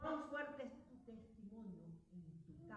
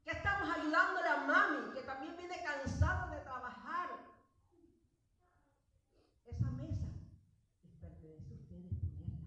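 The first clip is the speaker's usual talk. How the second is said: louder, raised preaching voice; fiery sermon delivery; preaching at full force; 4 semitones higher, 15 dB louder than usual. Acted. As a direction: drive it home from the pulpit.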